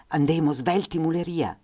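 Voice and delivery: urgent high call in Fiume dialect; mother urging her children in Fiume dialect. Acted. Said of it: "in dialetto fiumano".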